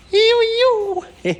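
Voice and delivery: high squealing ew with a laugh; delighted high squeal. Real, not acted. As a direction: squeal it out high and loud, then giggle. First glimpse of new bonnet.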